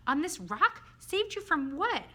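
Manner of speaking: high, loud puzzled questions; surprised questioning. Acted. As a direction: ask in amazement, drawing out the last question. Startled questions about being saved.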